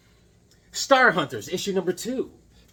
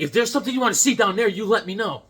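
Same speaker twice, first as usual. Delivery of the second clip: rushed warning; 5 semitones higher, 5 dB louder, faster than usual. Retold or imitated. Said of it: "He's like".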